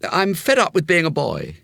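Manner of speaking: put-on young boy complaining; boy Jimmy. A narrator's reading. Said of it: "he said".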